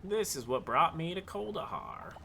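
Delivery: put-on Southern accent. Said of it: "much more southern now with the accent".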